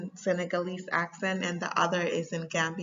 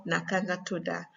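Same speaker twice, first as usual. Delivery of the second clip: Gambian Wolof, "tuda" closing on "ah"; Gambian accent; 3 dB quieter, faster than usual. Shown as a demonstration. Teacher offering learners the second option, Gambian accent. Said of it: "the other is Gambian accent".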